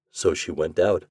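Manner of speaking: plain reading voice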